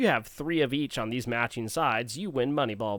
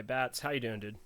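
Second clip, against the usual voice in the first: ordinary talk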